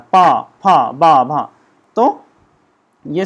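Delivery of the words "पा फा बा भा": पा, फा, बा, भा said loud, one by one; sounding out lip consonants. Shown as a demonstration. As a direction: repeat each syllable distinctly. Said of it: "ऐसे आपस में मिल रहा है".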